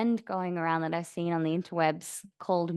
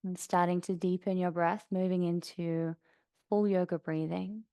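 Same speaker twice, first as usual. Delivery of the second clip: soft, even meditation voice with long pauses; guided meditation; 4 dB quieter than usual. Acted. Yoga teacher guiding class to notice sounds around them, calm meditation voice. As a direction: settle them gently into stillness, unhurried.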